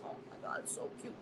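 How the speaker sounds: breathy whisper over a tiny kitten; whispered awe. Retold or imitated. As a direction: hush it like the kitten's right there.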